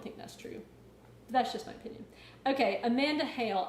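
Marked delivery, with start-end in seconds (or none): none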